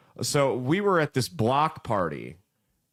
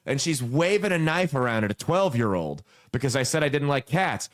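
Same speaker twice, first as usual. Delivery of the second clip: ordinary talk, nothing marked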